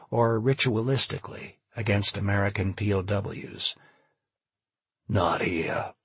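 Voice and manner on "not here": low, mildly weary protest; understated sighing disbelief; sighed. A narrator's reading. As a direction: let it out on a slight sigh. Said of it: "Charlie sighed".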